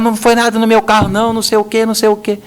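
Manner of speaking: grito imitado, bem mais agudo; gritando imitado. Retold or imitated. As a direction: imitando o cliente que berra ao telefone. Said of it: "E o cara gritando, falando alto". (cliente gritando indignado ao telefone, imitado)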